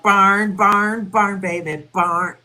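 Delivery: high, loud country twang on "barn"; country accent. Acted. Fan pleading to keep that lyric, country accent. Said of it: "the country accent again".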